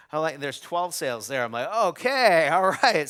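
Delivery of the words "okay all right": louder, higher burst of excitement; thrilled okay, all right; excited. Retold or imitated. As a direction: react to unexpected good news. Retold excitement seeing twelve sales.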